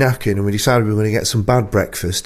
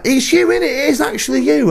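much higher, excited gushing at a stranger; starstruck fan. Retold or imitated. Bloke in a cafe gushing at the host, high and excited. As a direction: play a thrilled fan who thinks he has spotted a star. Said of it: "He's like".

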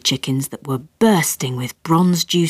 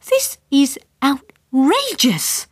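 snapped; 8 semitones higher, slower than usual. A narrator's reading. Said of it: "snapped my mother".

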